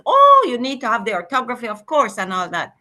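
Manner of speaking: normal talking voice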